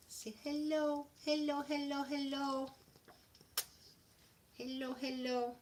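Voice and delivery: high, soft cooing hellos; pet voice. Real, not acted. Owner has her dog greet viewers, high soft pet voice.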